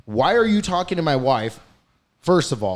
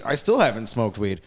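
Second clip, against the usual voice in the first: normal talking voice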